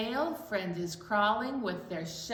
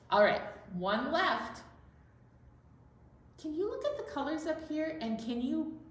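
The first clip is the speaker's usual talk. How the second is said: normal talking voice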